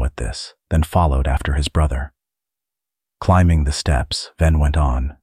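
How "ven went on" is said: ordinary delivery, nothing marked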